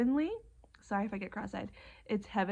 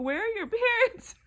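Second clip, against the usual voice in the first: high, loud question breaking into laughter; amused disbelief; 12 semitones higher, 6 dB louder than usual. Retold or imitated. Asking the hugging little girl, in high laughing disbelief. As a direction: squeal the retold question through a laugh. Retelling her reaction to the hug.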